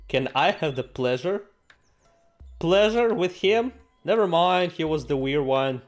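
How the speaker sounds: ordinary talk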